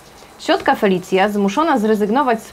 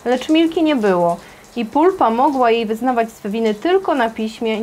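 ordinary delivery

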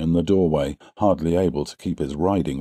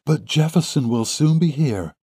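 high-pitched, hopeful woman's voice; spirited daughter; 8 semitones higher than usual. A narrator's reading. Daughter reassures her father, spirited. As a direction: reassure him with lifted pitch. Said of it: "she answered with spirit".

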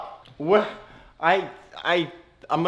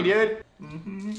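ordinary talk

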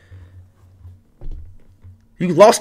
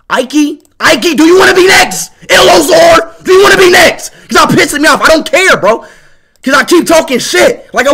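much higher, loud strained shout; angry yelling; 6 semitones higher, 6 dB louder than usual. Real, not acted. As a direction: scream the challenge at them. Yelling challenge at rivals.

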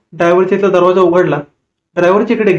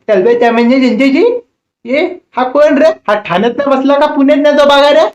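mocking old woman; 6 semitones higher than usual. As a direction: sneer at him in a shrill old-lady voice.

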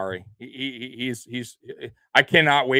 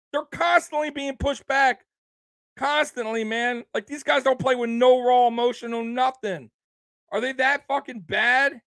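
high-pitched, worked-up rant; heated rant; 9 semitones higher than usual. Real, not acted. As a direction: vent your anger at the team. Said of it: "I'm just pissed".

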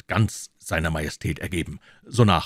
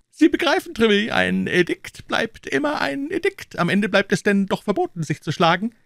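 very high-pitched king's voice; explaining king; 5 dB louder than usual. Acted. King reminding Treville of the duelling edict, high voice. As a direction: lay down the rule in a high royal voice.

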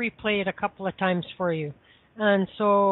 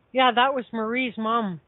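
ordinary talk

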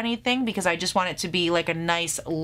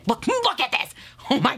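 excited squeal; 6 semitones higher than usual. Real, not acted. Vlogger shows fans the packaging, squealing with excitement. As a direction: voice leaps high in delight.

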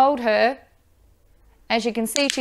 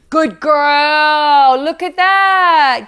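excited praise; 4 semitones higher, 8 dB louder, much slower than usual. Real, not acted. Dog succeeds at lift.

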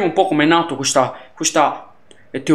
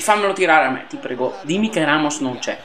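normal talking voice

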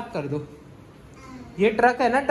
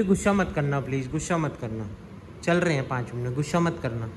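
much lower mock-pleading child voice; voicing the child; 10 semitones lower, 3 dB quieter than usual. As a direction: plead low, putting words in the kid's mouth. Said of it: "बोली". (बच्चे की ओर से धीमी गिड़गिड़ाती आवाज़)

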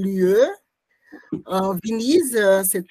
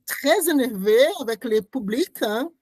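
ordinary delivery

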